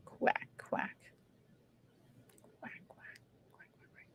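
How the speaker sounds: soft whispered quack quack; playful whispered duck noises. Acted. Playful toy duck sounds.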